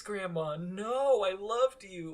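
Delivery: high, loud, slow anguished plea; mock heartbroken wail. Fan reacting to grandma's betrayal, high anguished mock plea. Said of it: "And I was like".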